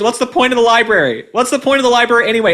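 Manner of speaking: high, fast exasperated questions; exasperated rant. Real, not acted. Vlogger mocking libraries, high exasperated rant. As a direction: throw the question up in disbelief.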